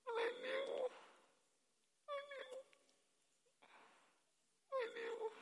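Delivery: very high, drawn-out acted wail; crying. Old person weeping bitterly over a lost calling, high crying wail. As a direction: wail it slowly through tears, high-pitched. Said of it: "And you cry and say".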